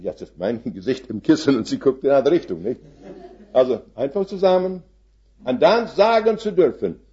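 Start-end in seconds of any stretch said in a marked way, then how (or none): 1.6-7.0 higher, through a laugh; marvelling with a laugh; preacher laughing in wonder, higher; laughing, moved. Real, not acted.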